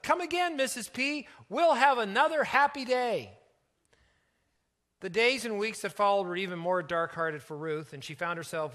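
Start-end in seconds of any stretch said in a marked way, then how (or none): 0.0-3.4 put-on high, loud child calling after someone. A narrator's reading. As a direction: call out cheerily as a little girl. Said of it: "Wendy called out".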